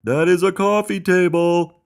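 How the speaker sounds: high, loud objection; full-voiced high shout; shouted protest. A narrator's reading. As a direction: yell it back insistently. Buddy keeps shouting in protest.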